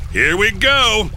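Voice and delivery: high, loud rallying call; excited shout. A narrator's reading. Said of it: "Coyote shouted".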